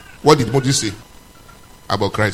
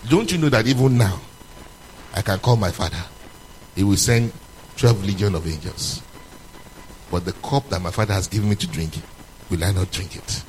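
calm Jesus; 8 semitones lower, 4 dB quieter than usual. Retold or imitated. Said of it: "Jesus told him".

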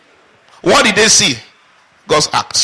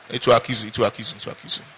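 hushed warning; 8 semitones lower, 8 dB quieter, faster than usual. Acted. Preacher repeats warning, dropping voice.

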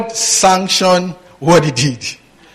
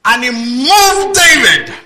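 slow, higher declaiming read; scripture reading; 8 semitones higher, slower than usual. Acted. Preacher reading the verse aloud to the church, slow and higher. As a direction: draw out the verse like a proclamation.

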